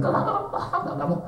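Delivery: refusing with a laugh, half joking; laughing refusal. Retold or imitated. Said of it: "saya mikir".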